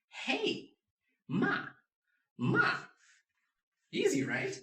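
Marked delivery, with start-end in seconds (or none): 0.1-2.7 "ma" high, loud and falling sharply; fourth tone. Shown as a demonstration. Teacher reviews fourth tone, ma as a loud sharp fall. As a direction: drop "ma" hard like an emergency "hey". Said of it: "Fourth tone is hey".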